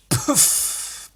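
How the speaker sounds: voiceless burst of breath; puff of water. Retold or imitated. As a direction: imitate a sharp spout of water. Imitating water blown from nose.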